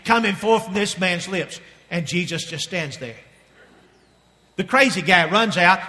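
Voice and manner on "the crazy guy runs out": ordinary delivery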